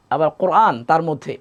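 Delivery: ordinary delivery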